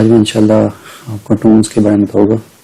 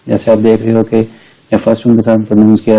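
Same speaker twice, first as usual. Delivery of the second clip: normal talking voice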